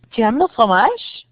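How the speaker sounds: Tu aimes le fromage said with a clear rise at the end; rising question. Shown as a demonstration. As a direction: lift the voice on fromage. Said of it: "You can use intonation".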